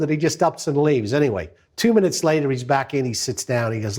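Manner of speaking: usual speaking voice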